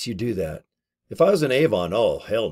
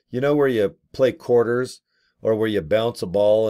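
ordinary talk

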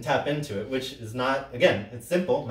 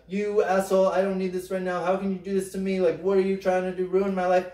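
retold scream; 7 semitones higher than usual. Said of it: "And he screams out".

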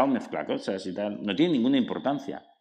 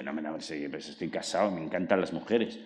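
lower, softer insisting; defensive self-reassurance; 5 semitones lower, 4 dB quieter than usual. Retold or imitated. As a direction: calmly insist you're fine. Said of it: "Y yo digo".

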